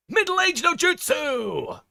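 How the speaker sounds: high, slow jutsu yell; ninja battle shout. Acted. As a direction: scream out the ninja technique. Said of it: "I'll be doing ninja voices".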